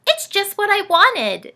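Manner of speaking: high, loud delighted voice; beaming. Acted. Dorothy with her new elf hat, beaming. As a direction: glow with delight. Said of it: "she beamed".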